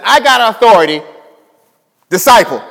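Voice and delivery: loud, firm command ending on disciple; commanding declaration. Acted. Preacher voicing Jesus' command to his followers, loud. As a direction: give the order as Jesus would.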